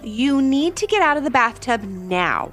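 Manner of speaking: lightly voiced scolding mother; mildly stern storybook mom.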